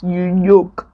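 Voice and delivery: "You yoke" with a y for the j, Akwa Ibom accent. Shown as a demonstration. Showing viewers her Akwa Ibom accent, light and playful. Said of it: "That's my Akwa Ibom accent".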